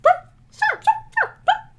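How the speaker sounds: very high mocking "sure"; sarcastic squeaky agreement. Mock agreement.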